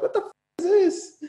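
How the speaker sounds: high, squeaky mock shock; a jump up in pitch at the DNA result; shocked disbelief. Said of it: "I'm like".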